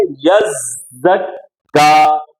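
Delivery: यज़्ज़क्का very slow, long buzzing zz, held kk; correct doubled reading. Shown as a demonstration. Teacher shows what the word really is, stretched recitation. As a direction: draw out यज़्ज़क्का, leaning on both doubled consonants. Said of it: "ये असल में क्या है".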